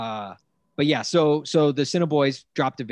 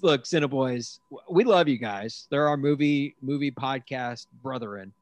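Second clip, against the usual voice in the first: usual speaking voice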